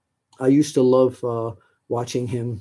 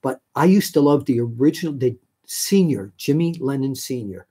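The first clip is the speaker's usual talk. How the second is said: ordinary delivery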